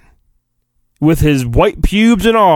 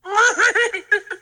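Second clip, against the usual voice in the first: high squeaky giggle, fading; a tiny creature's tickled giggle; Yoda giggle; 6 dB quieter than usual. Acted.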